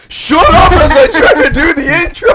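loud laughing yell; laughing shout. Real, not acted. Yelling at cohost mid-intro.

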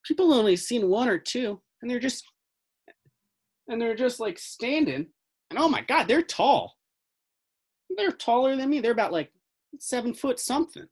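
put-on high-pitched older fellow with a Southern accent. Older fellow describing tall strangers to investigators, high Southern accent. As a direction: tell it high and Southern, like an amazed local. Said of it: "I'm doing a Southern accent".

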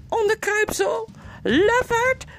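high, loud squealing insults; loud squeak. Acted. The captive mouse squeals insults at the slaver, loud and high. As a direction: squeak them out at full volume. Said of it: "piepte Rippertjeep luidkeels".

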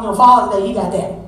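normal talking voice

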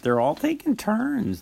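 ordinary talk

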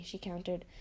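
usual speaking voice

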